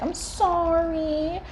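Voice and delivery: high, slow, pleading cat apology; sorry cat. Retold or imitated. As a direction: whine it like a guilty cat begging. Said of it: "be like".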